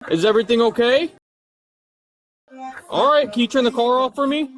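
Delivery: loud, high-pitched rousing call; calling out to wake. Real, not acted. Officer waking a woman asleep in her running car, calling out loudly. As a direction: raise your voice to get through to a sleeper.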